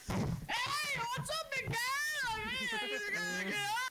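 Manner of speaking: high, booming mock parent wake-up; boisterous parents. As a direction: burst in hollering like an over-cheery parent. Parents bursting in to wake him.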